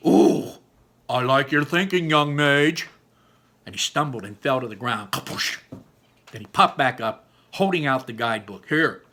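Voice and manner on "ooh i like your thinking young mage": excited. Acted. Barley excited by idea.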